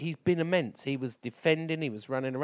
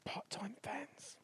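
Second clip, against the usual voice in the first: whisper; 10 semitones higher, 17 dB quieter, much slower than usual. Acted. Teasing jibe at his mates.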